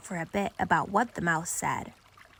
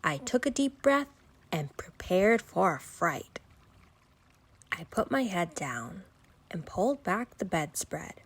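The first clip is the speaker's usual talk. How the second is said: usual speaking voice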